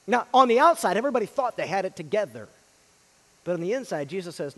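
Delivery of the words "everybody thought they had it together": ordinary talk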